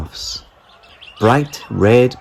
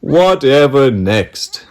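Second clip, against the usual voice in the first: hooting; 4 semitones higher, 4 dB louder, slower than usual. A narrator's reading. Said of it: "they hooted".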